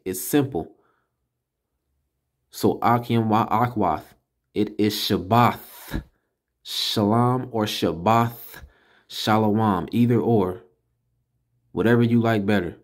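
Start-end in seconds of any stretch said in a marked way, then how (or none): 4.5-9.9 slow, deliberate it is Shabbath Shalom; slowed-down Shabbath Shalom, ending th; emphatic teaching. Shown as a demonstration. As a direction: spell out Shabbath Shalom slowly for the class. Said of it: "I have to put emphasis on this".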